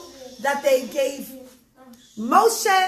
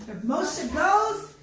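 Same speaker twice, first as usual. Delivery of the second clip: usual speaking voice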